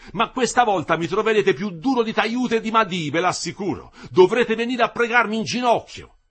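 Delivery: loud, high menacing warning; angry threat. A narrator's reading. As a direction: threaten them from above. Engineer threatening striking workers.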